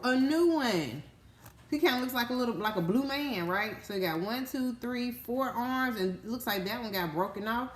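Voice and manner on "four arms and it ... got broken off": normal talking voice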